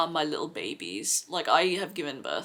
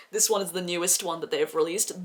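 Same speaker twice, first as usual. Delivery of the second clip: ordinary delivery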